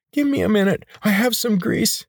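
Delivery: very high, squeaking and breaking to a whisper; panicked squeak. A narrator's reading. Healer squealing in fear that the ring is stuck. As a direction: squeal it in fright.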